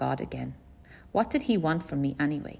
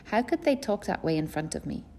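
ordinary delivery, nothing marked

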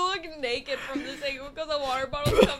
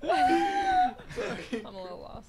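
laughing; 3 dB quieter, much slower than usual. Real, not acted. Laughing at a gross joke.

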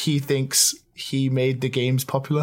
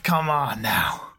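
whispering; 3 semitones lower, slower than usual. Acted. Mock-serious aside.

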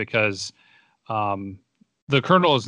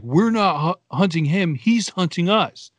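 freaking out; 7 semitones higher, 4 dB louder than usual. Retold or imitated. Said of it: "is freaking out".

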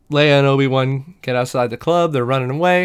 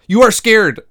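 high, loud "You are scared", quicker and more heated; exasperated insistence; 9 semitones higher, 8 dB louder than usual. Retold or imitated. Host contradicts ten-year-old Leia harder, loud high exasperation.

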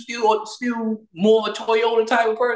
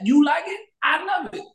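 shouted motto; 9 semitones higher than usual. Real, not acted. Shouting his motto at the complainers. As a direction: belt the motto out high. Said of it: "that's gonna be my motto all year".

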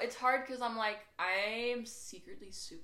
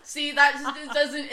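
usual speaking voice

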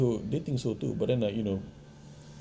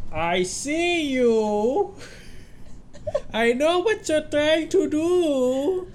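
very high, drawn-out cartoon voice; slow, squeaky taunt from a lurking creature; creepy singsong lizard; much slower than usual. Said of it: "You almost sound like that guy".

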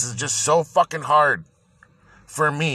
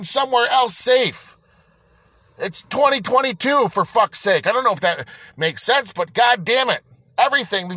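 higher-pitched, heated rant; real anger; 6 semitones higher than usual. Real, not acted. Venting to podcast listeners about being sick and stuck, real anger. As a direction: let the frustration boil over, pitched up. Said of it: "I'm a little angry".

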